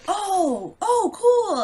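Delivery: high, wide-eyed oh cool; toddler voice. Retold or imitated. Dad imitating his son discovering planets, high toddler voice. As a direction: play the little kid amazed.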